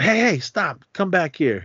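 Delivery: high-pitched mock summons; imitated calling out. Sonny stopping the boys as they leave, imitated calling out. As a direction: call them back, pitched well up.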